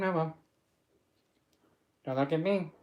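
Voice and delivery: normal talking voice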